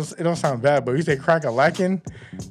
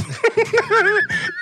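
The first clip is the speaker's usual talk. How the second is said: high laugh. Real, not acted. Host laughing at their own butter joke, high laugh. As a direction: burst out laughing.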